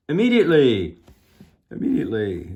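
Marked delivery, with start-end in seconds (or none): 0.1-1.0 high, loud command falling off at the end; bullhorn shout. A narrator's reading. Officer demanding the car stop, bullhorn shout. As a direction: holler it as an urgent order. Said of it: "shouted into his bullhorn".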